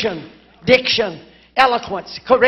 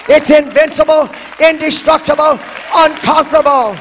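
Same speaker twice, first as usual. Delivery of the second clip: preaching, higher and louder, hammering each word; fervent preaching; 3 semitones higher, 5 dB louder than usual. Acted. Preacher quoting Paul on the invincible gospel, ringing preaching. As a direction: proclaim it like a revival preacher.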